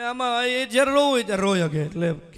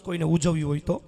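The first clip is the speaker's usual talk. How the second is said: normal talking voice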